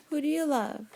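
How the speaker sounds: ordinary talk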